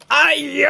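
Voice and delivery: sharp acted "ouch" yelp; cry of pain. Spell victim feels the heart jabbed, pained cry. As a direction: yelp as if suddenly stabbed.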